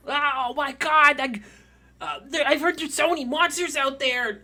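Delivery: put-on high, loud kid voice; excited child. Kids tell Vincent about monsters out there, excited child voice. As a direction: gush like an awestruck child.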